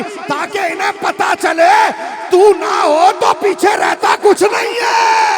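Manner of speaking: loud, high, impassioned sermon voice; fiery preaching. Acted. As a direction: preach it out at full force, soaring. Preacher at full force. (ऊँची आवाज़ में जोशीला बयान)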